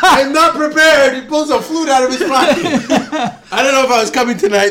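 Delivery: laughing mock imitation of the guest. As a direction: tease the guest by parroting him. Mocking the guest's entrance.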